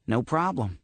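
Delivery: ordinary delivery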